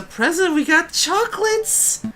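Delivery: hammed-up, high-pitched gift announcement; excited squeal. Offering the guard a bribe of chocolates, excited squeal. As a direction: climb into a very high, drawn-out squeal.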